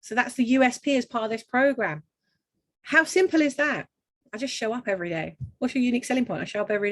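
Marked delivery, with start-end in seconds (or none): none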